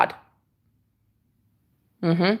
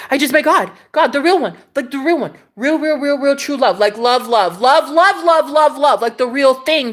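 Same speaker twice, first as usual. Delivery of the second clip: overjoyed; 5 semitones higher than usual. Retold or imitated. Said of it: "I'm like".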